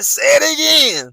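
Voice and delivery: high, loud demand; Italian waiter. Retold or imitated. The Italian waiter, in the retold story, asks again high and loud. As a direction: belt it out like the waiter. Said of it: "he's like".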